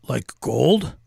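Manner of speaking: a hint of a miner voice, questioning; lightly voiced miner. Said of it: "That's my minor voice".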